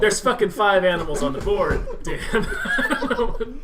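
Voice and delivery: high, loud, laughing protest. Real, not acted. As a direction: laugh through the words. Laughing while defending his clue.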